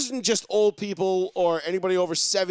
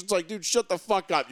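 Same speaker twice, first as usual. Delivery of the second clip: normal talking voice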